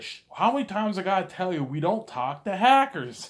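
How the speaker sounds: loud high exasperated cop; fed up with the kid; exasperated yell. Retold or imitated. Cop loses patience.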